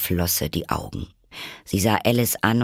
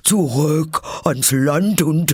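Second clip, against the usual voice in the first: high, loud turtle cry; excited cry; 9 semitones higher, 6 dB louder, slower than usual. Acted. Mock Turtle leaping about at the dance's climax, excited cry. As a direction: call out at the peak of frenzy.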